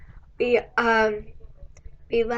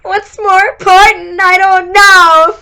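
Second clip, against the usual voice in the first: loud, high, drawn-out self-debate; mock agonizing; 7 semitones higher, 11 dB louder, slower than usual. Broke student weighing food against a book, mock agonizing. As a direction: stretch it out in hammed-up indecision. Acted inner debate over money.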